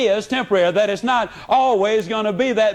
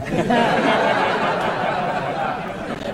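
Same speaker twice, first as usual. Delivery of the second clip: chuckle. Retold or imitated. Laugh after teasing line.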